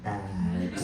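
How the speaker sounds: dropping low and soft on bad; deflated drop. Comic telling crowd a show went bad, sinking quiet. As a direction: let it sink flat and quiet.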